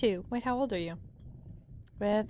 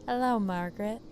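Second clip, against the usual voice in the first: ordinary talk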